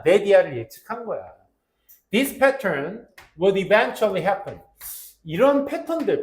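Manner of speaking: loud, energetic classroom delivery; lecturing teacher. Acted. Teacher explaining to students, loud energetic lecture. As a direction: teach it with full projected energy.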